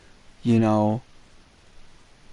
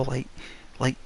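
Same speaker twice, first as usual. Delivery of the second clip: ordinary talk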